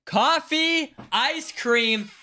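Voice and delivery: high, loud excited call-out. Real, not acted. Announcing the winning guess to chat, excited. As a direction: blurt the right answer out with glee.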